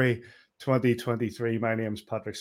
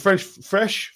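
loose dentures; 8 semitones higher, 5 dB louder than usual. Real, not acted. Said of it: "I'm gonna put my teeth back in".

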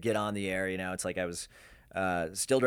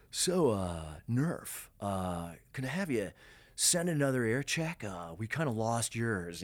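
F Poff impression; slower than usual. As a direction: do F's voice, dragging the words out. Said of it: "it's spot on F impression".

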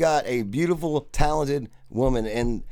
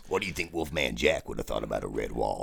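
Wolfman Jack voice; 14 semitones lower, 7 dB quieter than usual. Acted. Imitating the low radio DJ.

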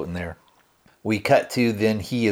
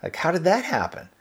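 genuine bewilderment; 6 semitones higher than usual. Retold or imitated. Bill asks Prin how he became the bad guy, genuinely bewildered. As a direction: lightly act out sincere, baffled questioning. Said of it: "he's saying this genuinely".

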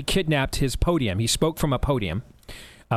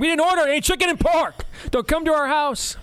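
mock angry yell; 12 semitones higher than usual. Deaf teacher yelling at prank callers, a mock angry high yell. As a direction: shout it back, pitched way up like an angry old man. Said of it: "he'd start yelling and screaming".

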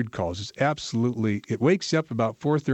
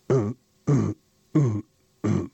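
bison grunt imitation. Shown as a demonstration. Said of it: "it goes more or less like".

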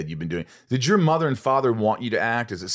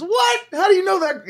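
mock surprise; 6 dB louder than usual. Retold quote of surprised man.

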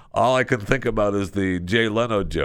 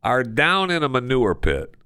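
ordinary delivery

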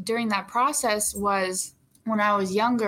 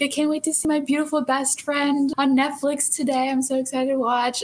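higher, gushing quote of best friends; imitating excited friends; 5 semitones higher than usual. Actor quoting her friends' texts before the premiere, gushing. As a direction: play a friend texting in excitement. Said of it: "They've been texting me".

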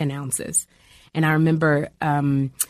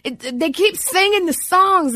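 put-on very high, slow child's complaint; whiny child voice; 13 semitones higher, slower than usual. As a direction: whine like a bored little boy, pitched way up. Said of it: "and Jeffrey said".

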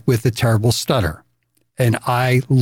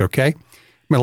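ordinary talk

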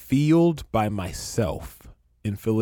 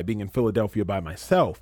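usual speaking voice

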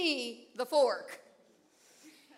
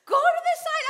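high, loud call from down the river; shouted call; 13 semitones higher, 7 dB louder than usual. Retold or imitated. Husband shouts to her from downstream, high and loud. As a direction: yell the instruction across the water. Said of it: "he's saying".

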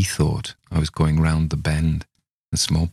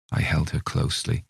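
ordinary delivery, nothing marked

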